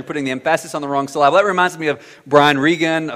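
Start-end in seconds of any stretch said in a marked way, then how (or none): 0.0-1.4 quick "emphasis" as em-FASS-is, "syllable" as suh-LAB-ul; fast joke misstressing, "emphasis" em-FASS-is and "syllable" suh-LAB-ul; wrong-syllable stress. Retold or imitated. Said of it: "a teacher that would say that".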